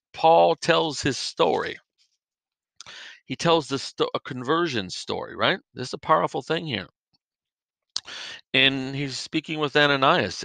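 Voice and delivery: normal talking voice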